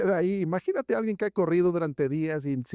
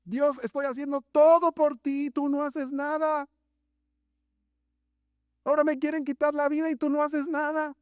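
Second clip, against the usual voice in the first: much higher, aggrieved whining protest; complaining Elijah; 9 semitones higher than usual. Acted. Elijah reproaches God for doing nothing, high aggrieved whine. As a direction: plead your case, hurt and indignant.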